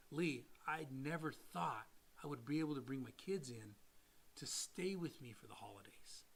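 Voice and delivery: soft, understated choked-up thanks; retold tearful gratitude. Client in tears about hosting his kids, retold softly. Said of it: "he's in tears now".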